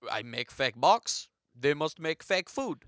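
put-on French-accented mime. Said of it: "Oh, let me translate".

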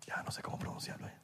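whispered aside while unsure of the guest's name. Real, not acted. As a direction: drop to a whisper to the co-host.

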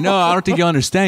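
high, fast, pushing protest; urgent protest. Retold or imitated. Handcuffed at his own party, fast frantic protest to cop. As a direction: blurt it out quickly, pleading to be heard.

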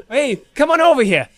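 high, hailing Brooklyn-Jersey call; Jersey accent. Shown as a demonstration. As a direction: wave them over in broad Jersey. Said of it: "Brooklyn, New Jersey accent".

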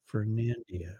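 ordinary talk

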